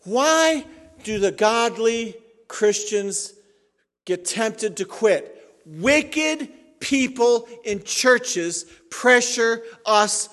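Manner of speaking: preaching at full force. Acted. Pastor driving home his point to the congregation, fiery preaching. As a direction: thunder it from the pulpit.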